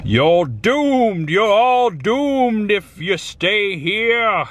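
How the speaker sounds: high, slow doom warning; slow ominous raspy drawl. Acted. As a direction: croak a slow prophecy of doom. Horror warning in put-on voice.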